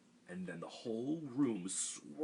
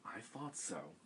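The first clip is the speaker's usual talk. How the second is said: softer, knowing smile; 8 dB quieter, slower than usual. A narrator's reading. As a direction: say it smiling, pleased. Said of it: "said with a smile".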